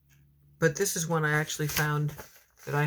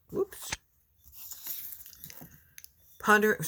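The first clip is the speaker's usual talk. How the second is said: normal talking voice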